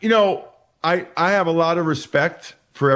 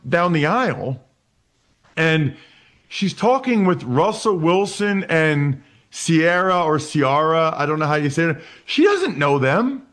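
usual speaking voice

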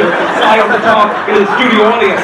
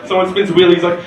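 ordinary talk, nothing marked

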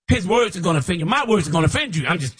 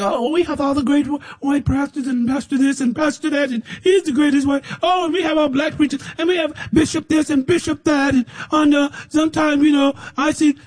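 mocking smug preachers; 10 semitones higher than usual. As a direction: mimic the self-satisfied churchmen with a high mocking shout. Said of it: "You just think".

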